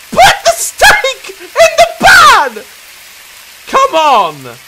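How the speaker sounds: high, loud, slow shout through laughter; laughing shout. Real, not acted. Gamer yelling at the fumbling steak, laughing. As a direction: yell it while cracking up.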